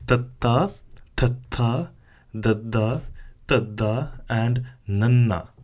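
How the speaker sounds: tatta, dadda, nanna with tongue on upper teeth; dental letters demo. Shown as a demonstration. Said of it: "And we generate the sound".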